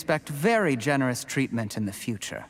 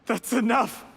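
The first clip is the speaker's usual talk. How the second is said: angry shout; 9 semitones higher than usual. Acted. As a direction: shout him down, furious. Cornered villain snaps at accuser.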